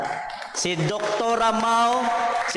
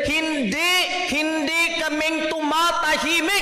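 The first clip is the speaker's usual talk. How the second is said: lively preacher rising; 7 semitones higher than usual. Real, not acted. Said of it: "sa amin sa born again".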